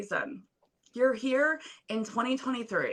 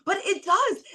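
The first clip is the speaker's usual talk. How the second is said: emotional burst; 8 semitones higher than usual. Real, not acted. Guest moved while praising host.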